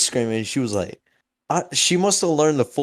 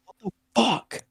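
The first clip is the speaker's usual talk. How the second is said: shocked mom; 11 semitones higher, 4 dB quieter than usual. Retold or imitated.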